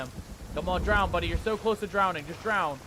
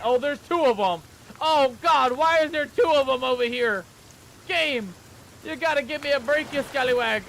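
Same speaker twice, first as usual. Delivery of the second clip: loud, high exclamations; alarmed protest; 5 semitones higher, 7 dB louder than usual. Real, not acted. Player begging the game for a break, alarmed shouting.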